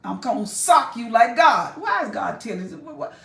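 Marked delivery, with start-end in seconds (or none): none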